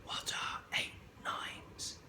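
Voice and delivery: unvoiced slow secret whisper; whispering behind a hand in class. A narrator's reading. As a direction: hide it from the teacher, breath only. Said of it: "whispered very softly to me".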